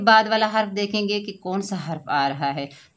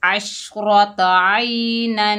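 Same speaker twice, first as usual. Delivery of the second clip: slow recitation with nasal ghunna; 3 semitones higher, 5 dB louder, much slower than usual. Shown as a demonstration. Teacher demonstrating ghunna in tanween, slow chanted recitation. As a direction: chant it slowly, humming the final nun. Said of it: "जैसे मिलाकर पढ़ते हैं".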